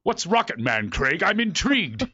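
imitated thespian, rising on the last words; mock-dignified British curiosity; Patrick Stewart. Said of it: "He goes".

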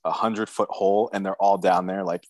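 ordinary talk

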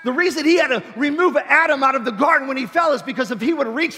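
high, loud preacher's cry; shouted preaching. Acted. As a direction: preach it full force. Preaching at full force.

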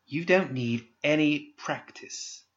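slow, high scolding; chastising. Acted. Pandora scolds Candy for her cruel practice, chastising. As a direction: scold her like a wayward child. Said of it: "Pandora said in chastisement".

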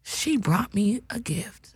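whispered, slow aside; secret whisper. Acted. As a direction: let the audience in on a secret.

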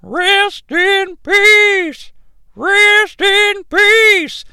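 high, drawn-out eerie wail; imitating a manager's strange slow chant; Paul Bearer. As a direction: stretch each word out slowly in a weird, high cadence. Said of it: "he says it in a weird cadence".

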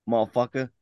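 usual speaking voice

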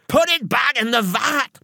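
high, frantic yelled order; yelling. A narrator's reading. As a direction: yell it in panic, pitched far up. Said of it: "Missus Ruddock was yelling at me".

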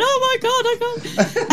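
mock panic. Comic stuck in the stage box, mock panicked squeak. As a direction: whimper it like frozen stage fright.

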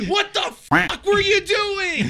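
high, drawn-out mock yell; yelled gaming outburst. Gamer re-enacting comms to teammate, exasperated yell. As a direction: scream it at a teammate, half laughing.

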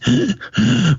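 mock gasps for air; acted-out asthmatic gasping; imitated wheezing. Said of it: "wheezing and wheezing".

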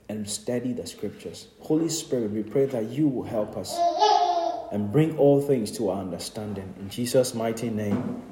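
quiet earnest prayer to the Father; closing prayer. Acted. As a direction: pray gently and reverently.

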